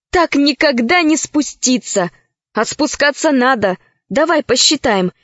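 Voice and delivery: ordinary delivery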